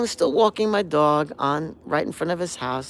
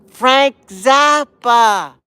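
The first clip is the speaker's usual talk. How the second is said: slow, higher, louder name; neighbor's answer; 8 semitones higher, 12 dB louder, much slower than usual. Retold or imitated. The neighbor naming the artist from the window. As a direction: call the name down deliberately. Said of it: "and he goes".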